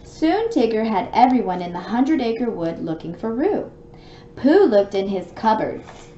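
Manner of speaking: lively read-aloud storybook voice; story narration. A narrator's reading. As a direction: read it out like bedtime for a little one. Reads storybook to child.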